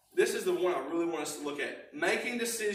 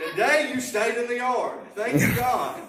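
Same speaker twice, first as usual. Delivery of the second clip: laughing relief; 5 semitones higher, 7 dB louder than usual. Real, not acted. Relieved thanks for the dog.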